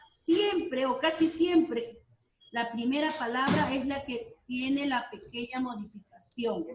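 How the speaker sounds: ordinary delivery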